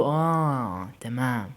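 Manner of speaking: low, soft and very slow; drawn-out acknowledgement. A narrator's reading. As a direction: stretch it right out, dropping low and quiet. Grudging acceptance of Youssef's answer. (موافقة ممطوطة بصوت واطي وبطيء)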